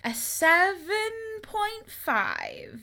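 high, very slow, stretched-out score; hesitant, elongated rating; drawn-out verdict. Real, not acted. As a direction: stretch each word while deciding. Announcing the rating.

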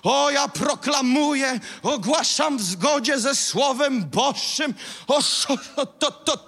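high, loud, stretched-out declaring; hammed-up high-pitched proclamation with stuttered syllables; mock shouted proclamation. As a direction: parody an overwrought prayer warrior proclaiming. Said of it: "I krzyczymy i nic się nie dzieje".

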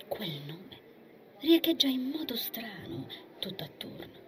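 hushed, eerie storytelling; whispered narration. A narrator's reading. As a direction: let it echo softly. (narrazione sussurrata)

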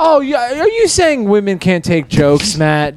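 high-pitched mock indignation; mock outrage. Teasing the co-host about women and jokes, mock outrage.

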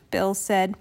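ordinary delivery

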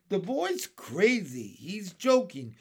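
high, slightly loud dismissal; disbelieving. A narrator's reading. Earthworm dismissing James's plan, mild disbelief. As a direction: scoff at a crazy plan.

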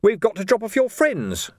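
lifted, announcing tone. A narrator's reading. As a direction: call it out a little, pointedly. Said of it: "raising his voice slightly".

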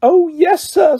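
very high, eager elf voice; Dobby, earnest. A narrator's reading. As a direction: squeak it out earnestly. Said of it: "said Dobby earnestly".

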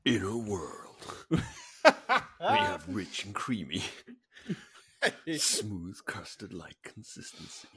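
slow, deep epic trailer narration; movie trailer voice. Shown as a demonstration. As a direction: announce it like a blockbuster trailer. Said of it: "the movie voice for smooth".

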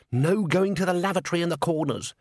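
high, pointed scolding; stern old witch. A narrator's reading. Granny warns her cat escort, sternly. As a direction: wag a finger at a naughty cat.

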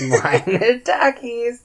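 high, slow, amused echo of the phrase; amused high repeat. Real, not acted. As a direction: savor it slowly, tickled. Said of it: "we should make that the name".

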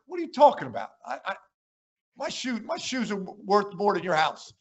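retold indignant comeback; mock indignation. Coach retold snapping back about clothes.

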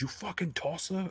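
high, soft mock-Cockney insult; imitated Cockney accent. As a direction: put on a Cockney accent to cuss someone out. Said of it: "with that Cockney accent".

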